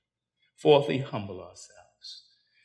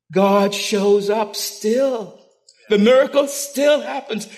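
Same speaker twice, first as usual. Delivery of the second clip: louder, higher, slowed preaching proclamation; preaching at full force; 9 semitones higher, 8 dB louder, much slower than usual. Acted. Preacher to Calvary congregation, rising preaching climax. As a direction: proclaim it like the peak of the sermon.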